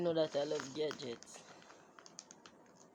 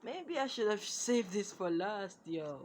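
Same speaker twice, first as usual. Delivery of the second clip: normal talking voice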